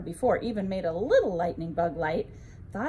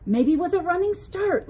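hopeful idea; 8 semitones higher, 6 dB louder than usual. A narrator's reading. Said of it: "Leo thought".

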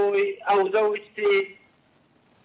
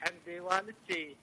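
faint, muffled phone voice; bad phone line; 2 semitones lower, 10 dB quieter than usual. Real, not acted. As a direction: speak quietly down a bad line. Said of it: "مو واضح صوتي".

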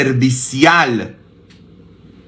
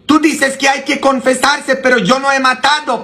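much higher, fast pleading parishioner; protesting parishioner; 13 semitones higher, faster than usual. Retold or imitated. Priest imitating a parishioner who claims innocence, high and fast. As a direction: play the indignant parishioner. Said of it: "muchos vienen conmigo y me dicen".

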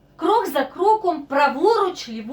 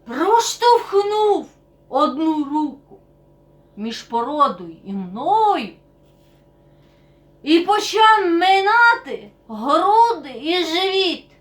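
slow, drawn-out, loud strain; laboured storytelling; 5 dB louder, slower than usual. Acted. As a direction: stretch the words as if squeezing through. Acting out being squeezed through rock. (натужно, розтягуючи слова)

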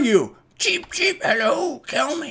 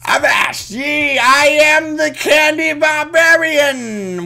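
slow, loud, higher pirate shout; 5 semitones higher, 4 dB louder, slower than usual. Acted. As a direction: bellow it like a pirate captain. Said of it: "the pirate voice".

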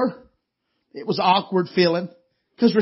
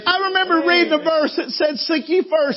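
full-force preaching; 6 semitones higher, 4 dB louder than usual. Acted. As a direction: preach it out, building to the peak.

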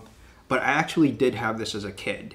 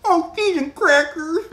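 very high, loud silly mimicry; mock echo; 7 dB louder than usual. Co-host parrots a friend's love of snacks, mocking squeal. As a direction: drag it out in a squeaky put-on voice. Mimics co-host's line back.

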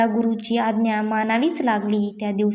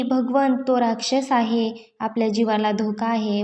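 plain reading voice

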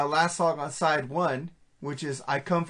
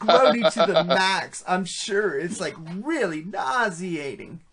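put-on Valley Girl voice; slower than usual. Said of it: "Valley Girl talk".

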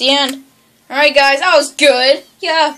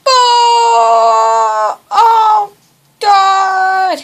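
long, raised cry of dismay; drawn-out groaning cry; dismayed wail; 5 semitones higher, 4 dB louder, much slower than usual. Real, not acted. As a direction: stretch each word into a wail. Something goes wrong in game.

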